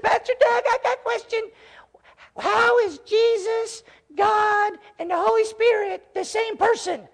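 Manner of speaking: put-on little boy's gruff voice, pitched very high; Jonas Sandel. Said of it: "he's got this cutest little gruff voice".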